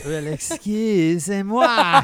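mock French.

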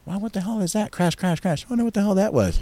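soft, high-pitched mock wariness; retold hushed wonder. Recalling crashes heard in the jungle, hushed wonder. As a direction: say it small and high, as if listening out.

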